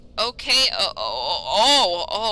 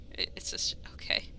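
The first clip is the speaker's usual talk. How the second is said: whisper; 13 dB quieter than usual. Real, not acted. Whispered aside.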